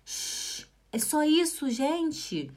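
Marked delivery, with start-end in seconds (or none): 0.1-0.7 soft, long hush; pretend shush. Teacher playing someone asking for silence, shushing. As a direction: shush a noisy person. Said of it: "pedindo pra alguém fazer silêncio".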